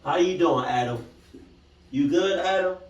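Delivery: loud, high-pitched check-in; loud high call, rising at the end. Real, not acted. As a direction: call out to someone, pitched right up. Calls out to Adam during creepy clip.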